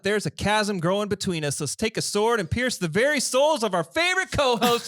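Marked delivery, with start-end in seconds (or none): 1.6-4.9 announcer intro. Acted. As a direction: hype announcer build-up. Hosts introduce co-host playfully.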